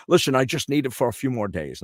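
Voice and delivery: lightly voiced Connery asking a favour; Connery voice asking to keep the spot; Sean Connery. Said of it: "He goes".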